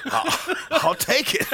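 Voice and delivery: high, loud laughing. Real, not acted. Accepting the job offer, laughing. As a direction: say it through a big laugh.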